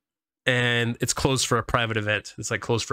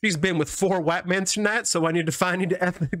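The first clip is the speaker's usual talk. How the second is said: higher, hammed-up Southern pimp accent; Deep South pimp voice; 6 semitones higher than usual. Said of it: "my pimp voice is like Deep South".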